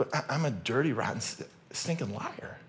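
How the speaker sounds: quiet, halting self-accusation; mock sheepish confession. Preacher turning the accusation on himself, soft mock confession. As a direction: drop the volume right down and stumble over it.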